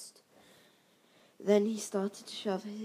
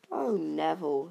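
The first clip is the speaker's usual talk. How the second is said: put-on sighing old woman; 8 dB louder than usual. A narrator's reading. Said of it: "he heard the old woman sigh".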